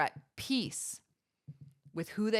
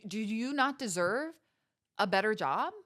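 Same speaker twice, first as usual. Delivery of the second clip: ordinary delivery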